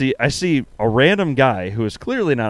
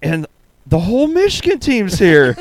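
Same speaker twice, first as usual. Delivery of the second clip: high, louder retold text; hyped-up imitation of an excited friend; mock-excited gloating; 8 semitones higher, 5 dB louder than usual. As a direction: play the friend's big news, pitched up.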